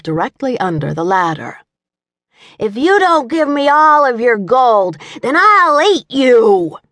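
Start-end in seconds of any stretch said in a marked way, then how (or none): 2.6-6.8 high, loud child playing a growling dragon; little-boy dragon menace, hammed up; boy's mock snarl. A narrator's reading. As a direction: threaten like a pretend monster. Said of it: "he snarled".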